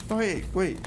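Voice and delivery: ordinary talk, nothing marked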